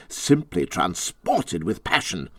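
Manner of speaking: plain reading voice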